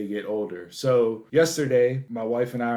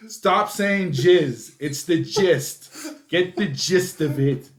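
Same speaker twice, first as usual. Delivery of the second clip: slow, higher, hammering "gist" against "jizz"; exasperated correction; 5 semitones higher, 4 dB louder, slower than usual. Retold or imitated. Dad correcting daughter's word, exasperated correction. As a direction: punch "gist" hard, each word spaced out.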